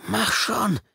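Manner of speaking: high shouted push; impatient yell. Acted. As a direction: bark at yourself to hurry. Inner voice urging him.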